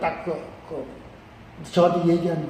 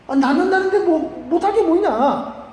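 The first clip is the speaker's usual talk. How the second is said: high, drawn-out self-persuasion; won-over bravado; 8 semitones higher than usual. Acted. General agreeing to try the cure, high and willing. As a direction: play a proud man talking himself into it. General talked round by his aide.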